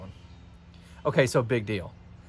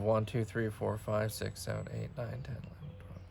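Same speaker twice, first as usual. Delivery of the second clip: very soft count, fading away; counting to self; 10 dB quieter than usual. Real, not acted. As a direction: count under your breath till it nearly vanishes. Counting grid units to self.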